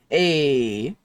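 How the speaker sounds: normal talking voice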